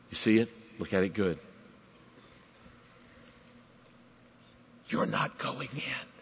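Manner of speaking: hushed divine voice. Retold or imitated. God speaking softly to Moses.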